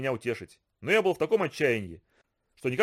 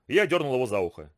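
ordinary delivery

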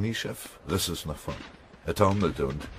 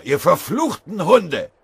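high, loud, drawn-out curse; enraged roar; 12 semitones higher, 10 dB louder, slower than usual. A narrator's reading. Soldier cursing the Red Army men, furious drawn-out roar. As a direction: bellow each word slowly in fury. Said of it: "brüllte Mörschinger".

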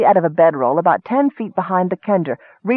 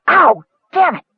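sudden pain; 9 semitones higher, 3 dB louder than usual. A narrator's reading. Captain hurt by falling dust.

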